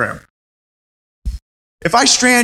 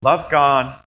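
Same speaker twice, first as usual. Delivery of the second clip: ordinary talk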